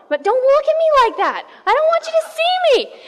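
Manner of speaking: high, climbing voice of sudden shame; acted panicked embarrassment. Adam and Eve suddenly ashamed before each other, high and flustered. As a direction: play someone desperate not to be seen, pitch leaping up.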